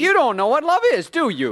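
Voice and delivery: high, loud bitter challenge; heated lover's-quarrel yell; angry outburst. Acted. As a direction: shout it back at your fiancée. Man lashes out in quarrel.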